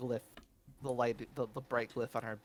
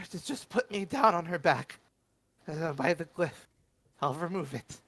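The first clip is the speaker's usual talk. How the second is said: nervous compliance; 5 semitones higher, 4 dB louder than usual. Acted. Wincing captive agreeing under threat.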